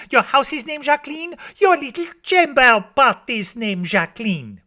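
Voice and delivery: put-on high, rattled-off child's list; Josette. A narrator's reading. Small girl reeling off names to a stranger, high and insistent. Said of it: "says Josette".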